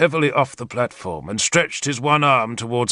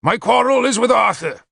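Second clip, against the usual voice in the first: raised, higher public declaration; addressing the hall; 10 semitones higher than usual. A narrator's reading. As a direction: project it to a whole crowd. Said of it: "but to the whole hall".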